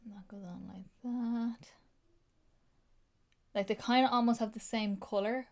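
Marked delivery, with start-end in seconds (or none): 0.0-1.7 hushed, intimate whispered line; soft whisper. Real, not acted. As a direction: whisper it while she works. Quietly applying swatch.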